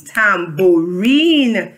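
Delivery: "tambourine" very slowly in three long syllables; broken into syllables. Shown as a demonstration. Teacher rounding off the spelling chant, "tambourine" syllable by syllable. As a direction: draw out each syllable of "tambourine". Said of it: "break it up into syllables".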